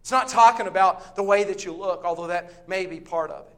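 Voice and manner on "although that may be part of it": ordinary talk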